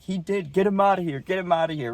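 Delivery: high-pitched, dismissive order; imitated ejecting umpire. Umpire throwing the player out, mock outraged.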